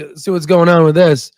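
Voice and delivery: ordinary delivery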